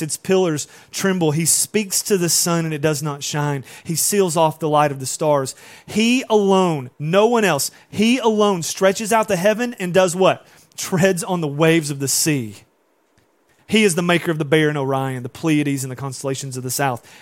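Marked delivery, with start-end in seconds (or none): 5.9-12.6 high, loud, slow emphasis on he alone; preaching at full force. Acted. Pastor stirring the congregation, loud and high. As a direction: preach it, lifting the church.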